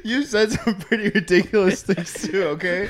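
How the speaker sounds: talking through laughter; laughing while protesting. Real, not acted.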